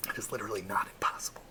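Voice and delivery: quick, near-whispered rebuttal; hushed aside. Acted. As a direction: drop to a quick whisper, as if confiding. Answers imagined objection in aside.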